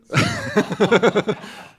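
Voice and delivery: high burst of laughter; laughing. Real, not acted. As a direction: laugh out loud at yourself. Lachen nach Platt-Lesung. (herzhaftes lautes Lachen)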